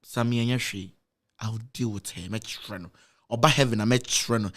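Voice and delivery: low, soft voice of God calming him; a deep, soothing divine promise; God reassuring Sammy he'll handle it; God's voice. Retold or imitated. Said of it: "Na Nyankopɔn ka kyerɛ me sɛ".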